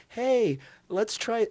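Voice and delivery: high, sweet mock coaxing; gentle reminder. Mocking the gentle approach to health advice, sugary and high. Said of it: "the gentle criticism".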